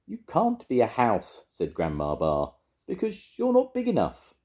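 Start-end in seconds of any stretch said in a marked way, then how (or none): 0.1-1.4 higher, insistent granny voice; disbelieving Grandma. A narrator's reading. Grandma mishears mouse as house, disbelieving. 2.9-4.3 very high granny reasoning; emphatic Grandma. A narrator's reading. Grandma putting the mouse right, high and emphatic.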